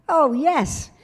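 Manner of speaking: loud, high, gleeful oh yes; real delight at sitting in the captain's chair. Real, not acted. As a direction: relish the memory out loud.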